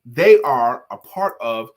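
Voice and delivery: slow, weighty, sincere; sincere emphasis. Real, not acted. Speaker telling the little girl the moral, slow and sincere. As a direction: land the moral gently and firmly.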